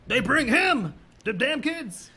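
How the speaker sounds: high, loud hammed-up yokel; Tom Arnold impression. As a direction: yell it like a spooked townie. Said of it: "Tom Arnold's like".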